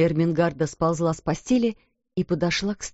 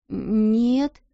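stammering; 3 semitones higher than usual. A narrator's reading. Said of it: "запнулась Эрмингарда".

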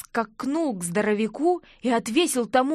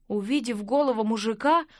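plain reading voice

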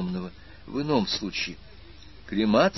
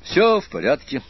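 higher, louder repeated reassurance; shaken-off gloom, emphatic; 7 semitones higher, 7 dB louder than usual. A narrator's reading. Aspirant reassures Annabel a second time, emphatically. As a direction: insist on it, lifting pitch and volume. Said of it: "встряхнулся аспирант".

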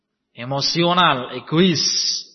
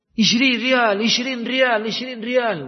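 egg seller's call; 5 semitones higher, slower than usual. Retold or imitated. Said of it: "orang kalau jualan telur di Saudi sana itu isrin".

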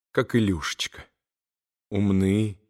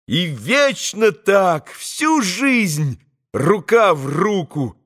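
high, slow jubilant cry building to a long hurrah; soaring, stretched-out boyish exultation; rapturous shout; 10 semitones higher, 4 dB louder, slower than usual. A narrator's reading. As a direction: cry out as an elated boy, climaxing in a cheer. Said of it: "Ещё раз восторженно прокричал Коля".

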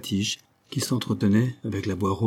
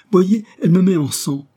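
high, loud cry of pain and fear; frightened cry; 10 semitones higher, 8 dB louder than usual. Acted. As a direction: cry out to the crowd, hurt. Child shows his wound. (cri de douleur)

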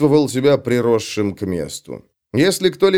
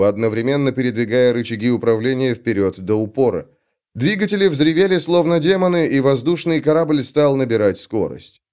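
plain reading voice

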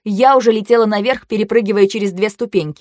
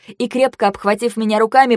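ordinary delivery

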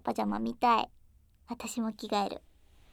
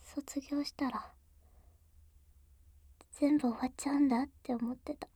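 pillow talk; 4 semitones higher, 5 dB quieter than usual. Acted.

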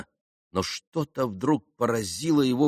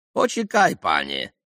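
mock Polish banker; 4 dB louder than usual. A narrator's reading. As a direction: ham up the pompous Polish dealer. Banker voice in story. (пародия на польского банкира, высоко и громко)

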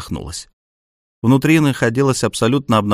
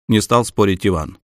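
ordinary delivery, nothing marked

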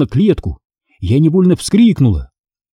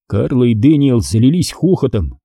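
ordinary delivery, nothing marked

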